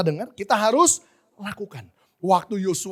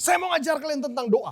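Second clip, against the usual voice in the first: lightly voiced teacher, pitched higher; lecturer's announcement; 7 semitones higher than usual. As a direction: announce the lesson to the class. Quoting his lecturer addressing class. (dosen mengumumkan pelajaran dengan nada tinggi)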